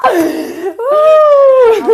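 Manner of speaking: loud, high laughing wail; helpless, high-pitched laughing protest; laughing in disbelief. Real, not acted. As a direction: wail it out through real laughter. Pranked guest reacts to the reveal.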